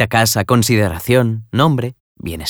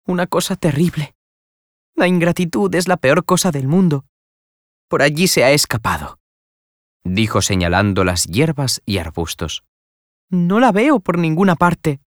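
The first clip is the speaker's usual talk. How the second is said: put-on higher, upset young woman; distressed young lady; 7 semitones higher than usual. A narrator's reading. Young woman telling the doctor Nela ran off, upset. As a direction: tell the doctor in dismay.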